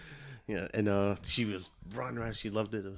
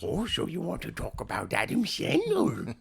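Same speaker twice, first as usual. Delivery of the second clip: higher, silly put-on voice; mock challenge in a silly character voice; goofy comic impersonation; 6 semitones higher than usual. Mock voice after Adam Sandler mention.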